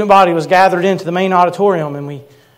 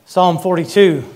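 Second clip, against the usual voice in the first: ordinary talk, nothing marked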